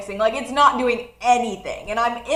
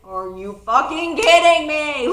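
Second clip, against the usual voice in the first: a touch louder, half-laughing outrage; laughing angry disbelief; 4 semitones higher, 6 dB louder than usual. Retold or imitated. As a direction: retell the fury with a laugh in it. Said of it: "I was very angry".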